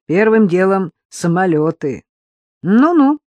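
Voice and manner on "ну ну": disbelieving, drawn-out ну-ну; doubtful, amused scoff; skeptical ну-ну. Acted. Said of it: "не поверил Борис Михайлович".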